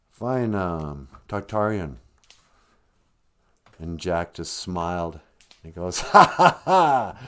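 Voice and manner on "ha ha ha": loud, slow, high "ha ha ha"; hammed-up evil laugh; gloating villain laugh. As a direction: cackle triumphantly, each ha separate. Jack gloats over dead giant.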